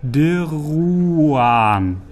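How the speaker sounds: each sound stretched out long; slow spelling out. A narrator's reading. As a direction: sound the name out slowly. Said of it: "buchstabierte der Rothaarige langsam".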